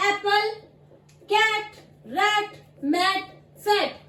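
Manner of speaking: एप्पल, कैट, रैट, मैट, फैट with open 'ae'; correct pronunciation. Shown as a demonstration. Teacher gives students the right forms, careful slow pronunciation. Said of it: "हम इनको बोलते हैं".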